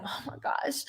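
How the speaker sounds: breathless whispered oh my gosh. Retold or imitated. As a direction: hush it like a dawning panic. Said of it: "And I was like".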